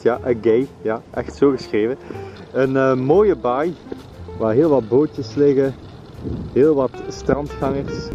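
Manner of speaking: ordinary delivery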